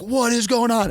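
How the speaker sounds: a retold boss snapping in confusion; startled demand. Boss demands answers mid-launch.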